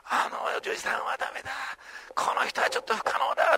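a hushed, breathy inner thought; whispered doubt. Pretend. A churchgoer secretly writing someone off, whispered. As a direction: whisper it like a private judgment.

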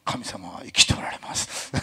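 slow, low, breathy whispered pastor impression; a whispered, slow preacher imitation; mock impression of a pastor. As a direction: hush it slowly and deeply like a revered pastor. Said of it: "誰の真似をしましょうかね".

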